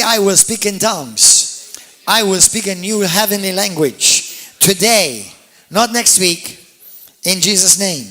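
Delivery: loud, emphatic preacher's call; forceful declaration. Real, not acted. Preacher leading everybody to say they will speak in tongues, forceful declaration. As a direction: proclaim it phrase by phrase for others to repeat. Leads congregation in a repeated declaration.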